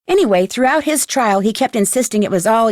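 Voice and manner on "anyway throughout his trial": usual speaking voice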